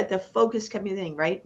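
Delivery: ordinary talk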